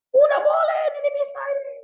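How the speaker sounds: mock the other sect's claim shrilly; mocking other school. Teacher mocks another school's ruling, high and fast. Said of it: "aliran yang sikoknya".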